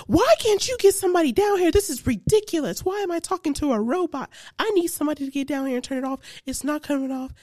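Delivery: exasperated texting rant. Retold or imitated. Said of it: "I'm texting the A I like".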